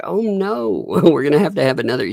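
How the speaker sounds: high-pitched "oh no" dismay; mock alarm. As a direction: play along with exaggerated horror. Dad plays along with distress.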